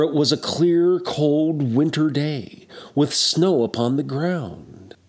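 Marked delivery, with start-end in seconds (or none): none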